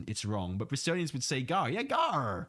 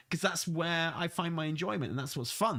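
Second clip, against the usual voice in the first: ordinary talk, nothing marked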